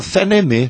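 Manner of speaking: Northeastern reading of the letters F N M as "Fenemê"; pronúncia nordestina. Retold or imitated. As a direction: say "Fenemê" as one word, fê-nê-mê, Northeastern style. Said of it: "o pessoal lá no Nordeste chamava". ("Fenemê" à moda nordestina, letras lidas como palavra)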